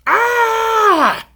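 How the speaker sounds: high, piercing shriek; enraged scream. A narrator's reading. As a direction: let out one heart-stopping shriek at full force. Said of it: "lifted his red head and screamed".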